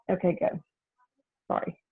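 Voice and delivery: breathless, quiet and panting; winded after running, gasping softly; out of breath. Real, not acted. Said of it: "I'm out of breath from running".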